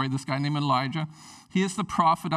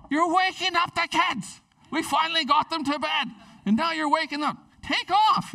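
annoyed scolding; 10 semitones higher than usual. Acted. Parent telling the midnight knocker to leave, annoyed. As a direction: shoo him away from the door.

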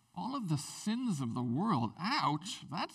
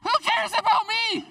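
high, loud and slow, disbelieving; incredulous outburst; 8 dB louder than usual. Retold or imitated. Husband answering his wife's apology, loud and amazed. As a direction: throw it out, laughing it off. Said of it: "I'm like".

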